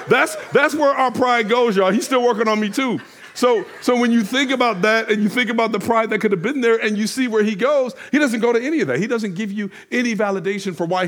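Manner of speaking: fervent pulpit climax, pastor exhorting his church; full-force preaching. Acted. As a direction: preach it hard to the congregation.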